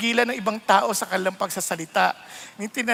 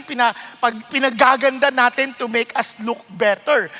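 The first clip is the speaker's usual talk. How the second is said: ordinary talk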